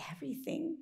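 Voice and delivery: hushed whispered word. A narrator's reading. Narrator hushing over the sleeping barnyard, whispered. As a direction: breathe it so nobody wakes.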